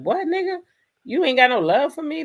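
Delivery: incredulous confrontation. Pretend. Imagined daughter confronting her cold dad, incredulous and high. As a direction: demand it like you can't believe it.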